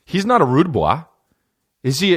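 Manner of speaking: ordinary talk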